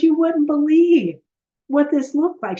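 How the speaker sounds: high, loud, thrilled retelling; excited. Retold or imitated. Client telling coach about the meal, high, loud and thrilled. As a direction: gush it like she can't believe it. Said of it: "She goes".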